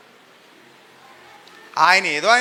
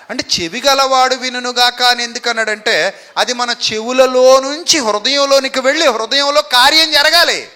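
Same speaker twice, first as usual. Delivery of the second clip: ordinary talk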